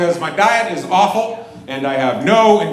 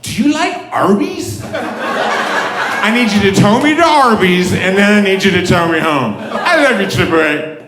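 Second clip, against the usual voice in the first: put-on drunk caller. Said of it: "call 'em up, you're like".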